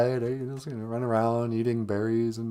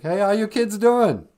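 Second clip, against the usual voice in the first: friendly God; 10 semitones higher, 8 dB louder than usual. Acted. God greeting Adam and Eve.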